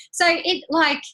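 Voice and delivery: ordinary talk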